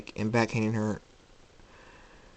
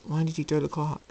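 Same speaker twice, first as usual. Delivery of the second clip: higher-pitched puzzled question; concerned princess; 6 semitones higher than usual. Acted. Celestia questioning Jacob about the clock, lightly concerned.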